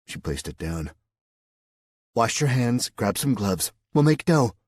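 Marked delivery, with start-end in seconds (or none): 2.1-4.5 higher, brisk hostess voice; cheerful organizer giving orders; Mary Lou. Acted. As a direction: bustle him into work.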